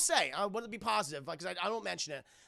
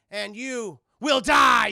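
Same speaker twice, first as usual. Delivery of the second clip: loud, drawn-out hammed-up threat; mock villain threat; 4 semitones higher, 15 dB louder, much slower than usual. [name] quoting what her mother would never say, mock booming threat. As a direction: boom it slowly like a melodramatic villain.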